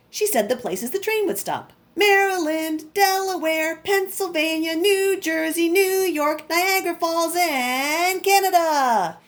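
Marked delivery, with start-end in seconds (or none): 1.9-9.2 high, loud list of stations; calling out stops. Acted. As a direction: sing out each stop down the platform. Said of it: "she yelled out the schedule".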